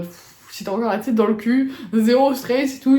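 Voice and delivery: ordinary delivery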